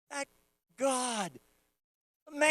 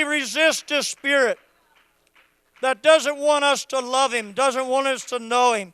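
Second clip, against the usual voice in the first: loud, high, impassioned preaching cry; preaching at full force; 4 semitones higher, 12 dB louder than usual. Acted. Pastor urging the congregation to worship, shouting fervently. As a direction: rouse the whole church to worship.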